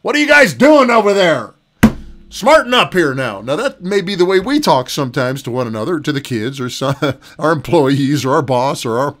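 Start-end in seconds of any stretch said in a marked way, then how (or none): 0.0-3.4 higher, louder mock harsh rebuke; mock scolding. Pastor imitating a harsh God scolding people, mock angry. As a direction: bark at the congregation like a stern God. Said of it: "cold cock us upside the head with and say".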